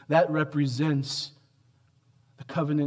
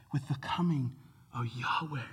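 whispered and much softer; reverent whisper; 8 dB quieter than usual. Real, not acted. Preacher revealing the divine name, whispered in reverence. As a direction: drop to a hush on the holy name.

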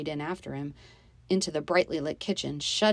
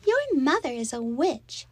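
put-on high, pouting child voice; spiteful little girl; 9 semitones higher, 4 dB louder than usual. A narrator's reading. Stepsister insulting the other girl's mother, spitefully.

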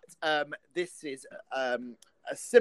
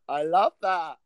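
ordinary delivery

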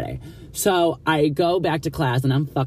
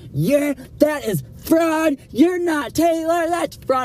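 mock principal, much higher and slow, squealing outrage; outraged principal; much slower than usual. Principal accuses student of fraud, high mock outrage. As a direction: play the principal as a squeaky, scandalized voice declaring fraud.

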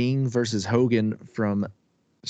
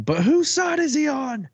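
Bobby Heenan yelling. Retold or imitated. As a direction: yell it like a frantic ringside announcer. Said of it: "Bobby Heenan yelling".

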